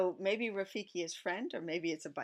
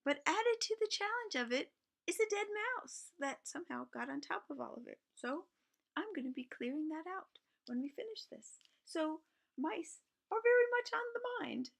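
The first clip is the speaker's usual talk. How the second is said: high, animated mock-horror telling; mock dramatic; 12 semitones higher than usual. Real, not acted. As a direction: ham up the gross news, high. Mock-dramatic toilet story.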